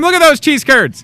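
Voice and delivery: high, loud excited shout. Real, not acted. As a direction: yell it out, thrilled at the food. Cheese curds arrive on set.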